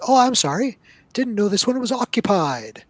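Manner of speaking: high, loud and flustered, backing off; a nervous little halfling blurting an apology; halfling player apologizing to the goblins, high and flustered; apologetic halfling in Goblin. Acted. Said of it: "holds up his hands in Goblin and says".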